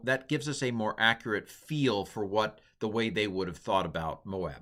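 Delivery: ordinary delivery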